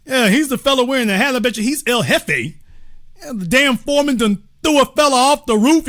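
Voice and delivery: ordinary talk, nothing marked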